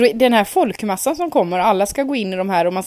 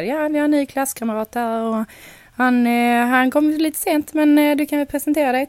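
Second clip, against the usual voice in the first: put-on Skåne accent teacher; 6 semitones higher than usual. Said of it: "hon är från Skåne, så hon säger så här".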